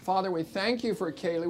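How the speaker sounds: ordinary talk, nothing marked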